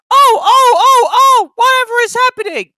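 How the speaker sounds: high, loud panicky truck scream. A narrator's reading. Trucks bumping into each other, screaming in panic. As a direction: squeal in alarm like silly trucks.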